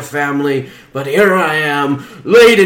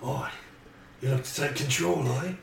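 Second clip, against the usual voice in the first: much softer, lower leering voice; lightly voiced creep; 4 semitones lower, 16 dB quieter, faster than usual. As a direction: leer at the lady. Same creep voice.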